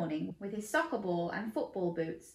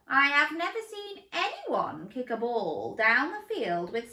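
coach chameleon voice; 10 semitones higher, 4 dB louder than usual. Acted. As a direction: play the enthusiastic coach. Coach character voice.

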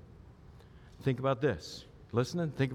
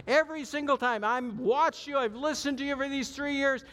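much higher and louder, insistent and boastful; protesting Peter; 10 semitones higher, 4 dB louder than usual. Retold or imitated. Peter arguing with Jesus he's wrong, high and loud. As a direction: argue like you know better than Jesus. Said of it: "Second time he denies it".

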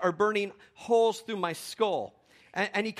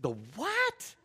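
high rising mock disbelief; hammed-up incredulous question; 5 semitones higher than usual. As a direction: shoot up on WHAT, totally baffled. Baffled reply to the rival coach.

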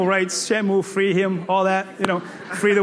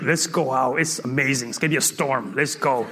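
very low, softer daring voice; deep, understated urging; voice of Faith; 10 semitones lower, 5 dB quieter than usual. Acted. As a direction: coax a friend into adventure in a deep murmur. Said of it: "Faith is like".

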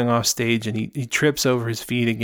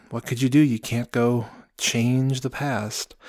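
ordinary delivery

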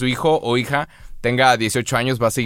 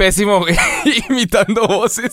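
laughing; 9 semitones higher, 4 dB louder than usual. Real, not acted. Admits being bad at voices.

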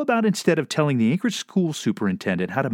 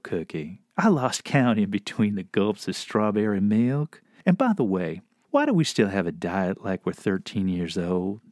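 southern inner voice; 4 semitones lower than usual. Acted. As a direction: chide kindly in a syrupy Southern accent. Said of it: "best inner voice ever".

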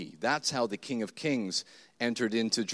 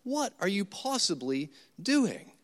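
exasperated plea; 7 semitones higher, slower than usual. Retold or imitated. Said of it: "times like that where you are thinking".

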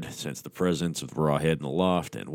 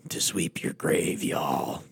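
slow breathy rasp instead of a boom; whispered monster threat; much slower than usual. Acted. Rawhead answering the hunter, hushed and slow. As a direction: hiss it slowly with menace. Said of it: "Rawhead boomed".

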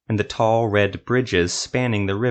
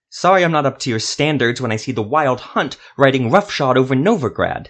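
higher, louder bitter sarcasm; heated sarcasm; 4 semitones higher, 5 dB louder than usual. A narrator's reading. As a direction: throw the apology back at him. Silva sarcastic heated reply.

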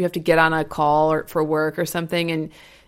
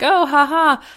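dismissive mock laugh; 9 semitones higher, 6 dB louder than usual. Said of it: "sounds kinda like".

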